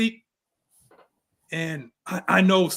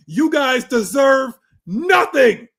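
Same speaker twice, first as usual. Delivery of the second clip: slow, loud, higher wrestler promo; John Cena promo; 5 semitones higher, 7 dB louder, much slower than usual. Pretend. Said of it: "the John Cena promo".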